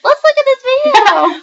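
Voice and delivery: put-on high, slow little old woman voice; quavery old lady voice; very old faint voice. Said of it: "We're gonna talk like little old women".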